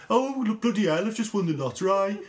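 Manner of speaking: a hint of a glum Brummie even for good news; mock Brummie. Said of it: "You could tell them".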